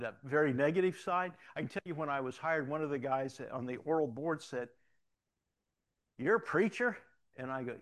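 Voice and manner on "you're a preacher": louder, higher mock incredulity; incredulous question. Board member to new hire, incredulous question. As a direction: question it loudly, pitched up in disbelief.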